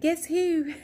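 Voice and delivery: a sing-song tease from behind; playful boyfriend. Retold or imitated. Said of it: "he's all like".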